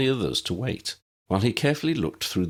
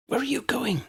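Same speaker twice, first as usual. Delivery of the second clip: Tally hissing. A narrator's reading. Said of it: "Tally hissed".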